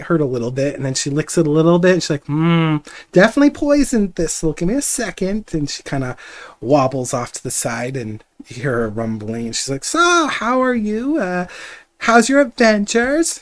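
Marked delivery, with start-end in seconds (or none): 2.3-5.5 put-on high, loud old woman diagnosing; old lady voice. Herbalist examines the wound. 9.8-13.4 yelling. Acted. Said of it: "kinda yelling loud 'cause she has to hear herself".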